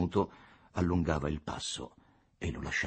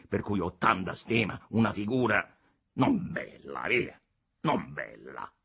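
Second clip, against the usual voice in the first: condescending deputy, admonishing; 6 semitones higher than usual. A narrator's reading. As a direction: chide him with feigned regard. Capolino admonishes Costa. (deputato che ammonisce con condiscendenza)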